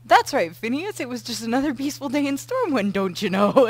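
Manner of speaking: a hammy Minnesota twang; a hint of a Minnesota accent; mock Minnesota accent. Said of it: "I'd be more like".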